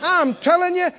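high, eager rallying of the crowd; rally them like a bold young leader; bold Caleb. Retold or imitated. Caleb rallying the scared people, high and eager. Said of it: "Caleb and Joshua got up and said".

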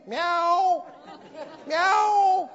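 high, plaintive mock meows; cat meowing. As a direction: mew like a begging stray cat. Cat back that evening.

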